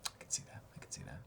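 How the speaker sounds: breathy whispered aside; hushed aside before starting the show. Real, not acted. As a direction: whisper it under your breath, repeated. Repeated aside to co-host.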